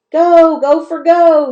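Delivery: very high, loud chant; girls shouting. A narrator's reading. Girls shouting to cheer the gopher on. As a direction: yell the cheer with all you've got. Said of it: "shouted the girls".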